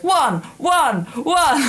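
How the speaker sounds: high, loud mock trainer calls; instructor's repeated shout. Imitating the instructor piling on burpees, mock shouting. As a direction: fire out each count like a class instructor. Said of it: "when he shouts out one".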